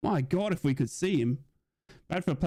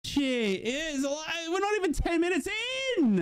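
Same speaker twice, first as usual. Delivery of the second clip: stunned dismay. Real, not acted. Player injured, stunned dismay.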